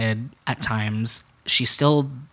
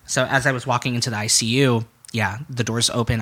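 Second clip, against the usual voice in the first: ordinary talk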